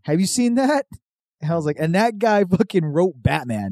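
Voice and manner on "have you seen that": much higher, needling rise; mock taunt aimed at the writer-director; mocking jab. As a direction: poke fun with a high teasing question.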